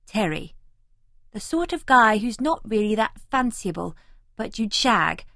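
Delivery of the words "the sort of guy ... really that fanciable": ordinary delivery, nothing marked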